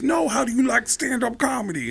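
high, insistent put-on voice; Tracy Morgan impression. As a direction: press the question in high mimicry. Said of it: "And he goes".

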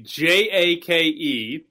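slow letter by letter spelling. Shown as a demonstration. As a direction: spell each letter slowly. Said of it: "So Jake Odorizzi".

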